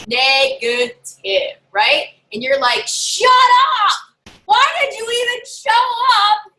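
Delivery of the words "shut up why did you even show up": high, louder mock yell at a heckler; mock scream. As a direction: imitate shouting down a rude guest. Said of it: "In my head, you're screaming that".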